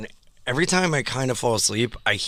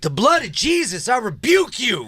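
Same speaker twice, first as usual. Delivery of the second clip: mock fervent church rebuke; praying rebuke; 11 semitones higher than usual. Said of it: "the one woman's like".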